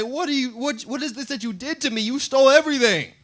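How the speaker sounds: the cheated man crying foul; a victim protesting the theft; indignant robbed trader. Retold or imitated.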